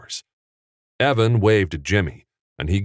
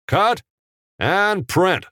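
calling out; 12 semitones higher, slower than usual. Acted. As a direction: call cut across the set. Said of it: "Capra called out".

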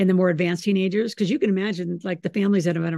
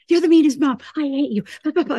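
higher, louder mock tantrum; mock teen yell; 8 semitones higher, 6 dB louder than usual. Mother imitating her son shouting that she's mean. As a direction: ham up a sulky son's outburst. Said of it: "son yelling in retold story".

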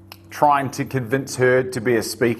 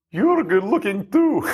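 imitating his wife's Russian accent, higher and slow; Anna's Russian accent; 9 semitones higher than usual. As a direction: put on a Russian accent as his wife, high and unhurried. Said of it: "she said in this beautiful Russian accent".

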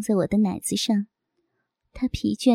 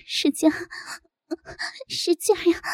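high, gasping moans; breathy high-pitched moan; moaning cry; 7 semitones higher than usual. A narrator's reading. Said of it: "我呻吟地叫着".

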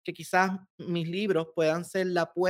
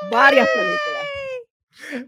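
excited; 5 dB louder, much slower than usual. Real, not acted. Excited stretched claim.

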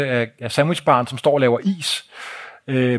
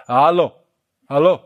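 Bosnian phone hallo; 4 dB louder than usual. Retold or imitated. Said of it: "Man siger".

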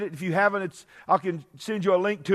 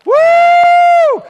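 whooping shout; 13 dB louder than usual. Real, not acted. Preacher's excited shout.

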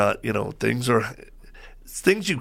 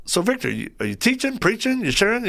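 higher, lightly voiced friend asking; Greg Laurie; 10 semitones higher than usual. A friend asking Victor if he's preaching, light high impression. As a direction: pitch it up like a cheery questioner. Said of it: "he goes".

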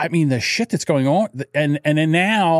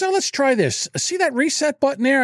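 higher, breezy tech support guy; imitating tech support; 9 semitones higher than usual. Mimicking tech support on the phone, breezy and higher. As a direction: play the cheerful support guy. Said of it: "He goes".

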